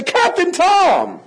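imitated excited cry, high and drawn out; kids' excited call. Kids spotting their old church teacher, imitated excited call. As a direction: stretch the name out in a high call. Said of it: "they wouldn't say".

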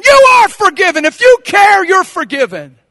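loud, high preaching rebuttal; emphatic shout. Acted. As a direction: hammer the assurance home. Shouts answer to doubter.

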